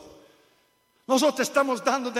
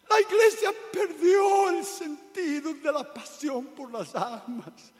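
fiery shouted sermon, building louder; preaching at full force; 5 semitones higher, 6 dB louder than usual. Acted. Preacher denouncing the church to his congregation, shouting. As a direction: thunder it at the congregation.

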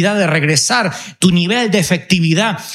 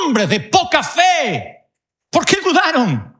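higher, slow reproachful Jesus voice; Jesus rebuking; 8 semitones higher, slower than usual. Acted. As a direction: chide them slowly from above, as Jesus. Jesús reprende a discípulos.

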